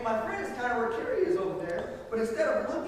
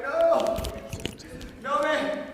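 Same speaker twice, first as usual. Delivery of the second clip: louder, higher panicked "no"; loud scared plea; panicked refusal; 6 semitones higher, 5 dB louder, much slower than usual. Retold or imitated. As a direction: refuse like your life depends on it. Refusing to jump.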